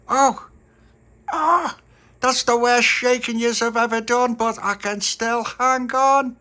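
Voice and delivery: high, strained cry of effort; gritted hanging-on voice, defiant; squeaky, strained little character voice; straining to hang on. Acted. Dolores clinging while being shaken.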